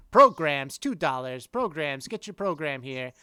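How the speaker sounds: put-on loud old-timey program hawker; old-timey vendor. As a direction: call it out like a ballpark vendor. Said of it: "the old-timey voice".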